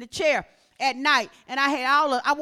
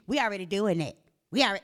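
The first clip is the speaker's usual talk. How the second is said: dismissive people; 6 semitones lower, faster than usual. Retold or imitated. Mimics people who brush off warnings.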